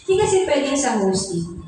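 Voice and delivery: usual speaking voice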